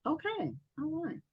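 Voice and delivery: usual speaking voice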